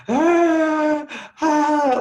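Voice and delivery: high, loud playful fake crying; mock crying. A father copying his crying son, playfully. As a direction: cry along to mirror the baby. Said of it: "mirror him".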